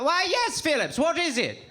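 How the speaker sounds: high, hammed-up British old boy; old dusty gentleman. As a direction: play a stuffy old colonial Brit.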